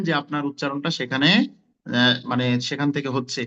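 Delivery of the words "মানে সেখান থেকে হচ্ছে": ordinary talk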